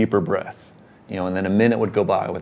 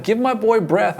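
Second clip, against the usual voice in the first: crying out; 11 semitones higher, 4 dB louder than usual. Retold or imitated. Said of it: "I'm crying out".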